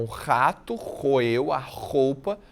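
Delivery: rato roeu roupa with a rasping R on each; slow, scraping the R like gnawing; stressed R sounds. Shown as a demonstration. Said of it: "esse barulho seria específico do ratinho". (marcando o R como barulho de roer)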